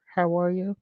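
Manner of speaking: ordinary talk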